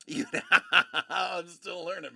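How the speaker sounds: real burst of laughter breaking up the words; amused, laughing admission. Real, not acted. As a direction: laugh hard, then admit it. Laughing at own lack of knowledge.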